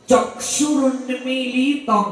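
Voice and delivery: চক্ষুরুন্মীলিতং slow, each syllable clear, Sanskrit sounds; careful Sanskrit recitation of চক্ষুরুন্মীলিতং; Sanskrit pronunciation. Shown as a demonstration. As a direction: spell out চক্ষুরুন্মীলিতং slowly in Sanskrit style. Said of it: "মন্ত্রটা যখন উচ্চারিত হবে".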